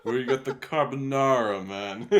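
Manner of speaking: put-on loud, high wiseguy accent while laughing; laughing mock Italian-American accent. Joining the restaurant joke, laughing, in a mock Italian-American accent.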